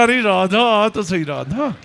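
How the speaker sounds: coaxing man. Retold or imitated. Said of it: "कह रहा". (धीमी, फुसलाती आवाज़ में बुलाना)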